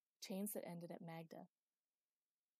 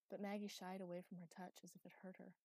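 usual speaking voice

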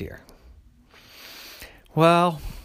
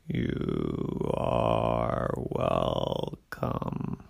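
very low, very slow tortoise; sleepy, polite old tortoise; 12 semitones lower, much slower than usual. A narrator's reading. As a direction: drag out every word like an ancient tortoise. Tortoise voiced in story.

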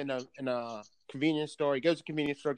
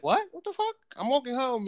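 much higher, incredulous retold reply; startled protest; 11 semitones higher than usual. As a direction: imitate a baffled man protesting to police. Acting out startled man stopped by police.

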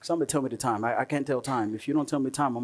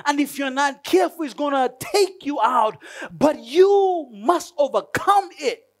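God warning Cain; 7 semitones higher than usual. Pretend. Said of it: "God says to Cain".

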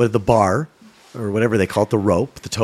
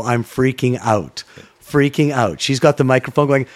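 ordinary delivery

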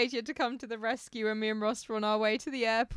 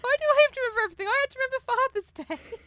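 high, fast mock complaint; mock outrage at always having to remember; exasperated rant; 6 dB louder, faster than usual. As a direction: spit it out fast and high, fed up.

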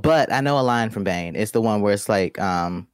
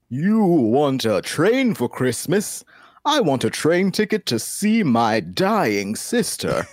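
high, slow, gloomy Santa voice; sad Santa; 6 semitones higher, slower than usual. Acted. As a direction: play a Santa down on his luck. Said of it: "a Christmas Santa down on his luck".

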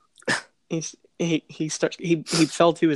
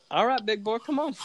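mock taunt; 8 semitones higher than usual. Taunting friend before wrestling.

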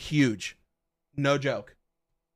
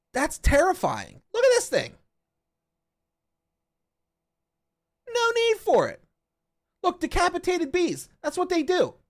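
freaked out; 8 semitones higher than usual. Real, not acted. Streamer showing Jordan murder hornets, high freaked-out squeal. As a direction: squeal at how terrifying the hornets are.

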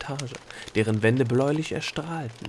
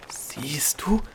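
breathy whisper, a little higher; 4 semitones higher than usual. A narrator's reading. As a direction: whisper it to her, pointing. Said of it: "wisperte Opal".

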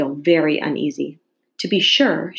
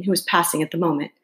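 usual speaking voice

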